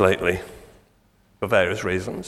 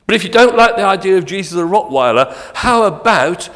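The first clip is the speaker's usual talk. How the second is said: loud and higher, building to a boom; preacher's triumphant build to the Lion; rising proclamation; 7 semitones higher, 7 dB louder than usual. Acted. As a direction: proclaim it grandly.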